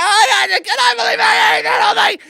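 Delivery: high-pitched hammed-up meltdown; hysterical shriek. Woman realizing she ate the whole Blizzard, hysterical shriek. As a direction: wail it in comic horror, pitch way up.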